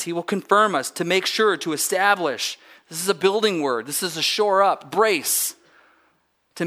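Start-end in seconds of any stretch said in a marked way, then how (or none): none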